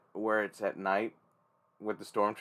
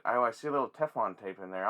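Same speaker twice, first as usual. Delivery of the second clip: usual speaking voice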